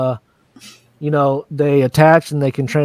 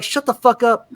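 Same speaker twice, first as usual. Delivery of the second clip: mock snap; 7 semitones higher than usual. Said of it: "he's like".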